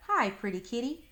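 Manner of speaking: high sweet singsong. Acted. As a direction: coo it up high. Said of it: "the girl sang".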